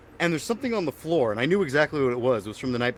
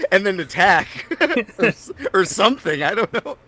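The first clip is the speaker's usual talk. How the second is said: loud and high through real laughter; real giggling, voice pitched up; laughing; 8 semitones higher, 8 dB louder than usual. Real, not acted. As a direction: crack up at your own joke. Cracking up at his own joke.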